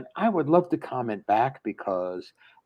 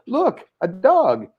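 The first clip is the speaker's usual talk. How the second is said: distracted excitement; 7 semitones higher, 6 dB louder, slower than usual. Distracted husband interrupts serious talk.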